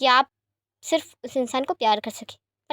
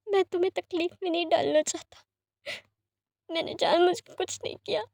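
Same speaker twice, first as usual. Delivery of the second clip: higher, teary pleading sorry; tearful apology; 6 semitones higher than usual. Acted. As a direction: apologise through tears. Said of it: "आंखों से आंसू निकलने लगते हैं".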